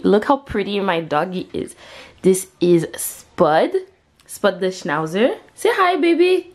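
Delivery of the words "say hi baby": high-pitched coo to a pet; coaxing pet talk. Real, not acted. Owner coaxes her dog to greet viewers, pet talk. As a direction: coax sweetly in a high voice.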